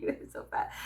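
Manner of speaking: breathy whisper, just air. Real, not acted. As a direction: whisper it like a secret aside. Whispered repeat of fat pets.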